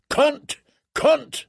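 high, explosive one-word roar; roared curse. A narrator's reading. Northman roaring at the woman he fights as he strikes. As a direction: roar it out like an axe blow. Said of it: "he roared each time he struck".

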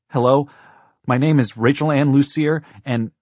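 put-on high, loud child on the phone; urgent little girl. A narrator's reading. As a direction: announce yourself urgently to emergency services. Girl urgently calls emergency line.

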